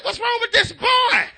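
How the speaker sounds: high, drawn-out father's complaint; mock-alarmed parental question; exasperated bafflement. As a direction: re-enact a parent at a loss, slow and high. Baffled father over crying baby.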